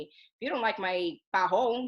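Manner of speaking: ordinary delivery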